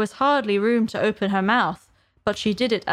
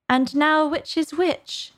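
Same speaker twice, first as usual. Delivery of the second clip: higher, slow wondering question; puzzled Alice; 7 semitones higher, slower than usual. A narrator's reading. Alice to herself, nibbling the mushroom, puzzled. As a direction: wonder it aloud, drawn out and high.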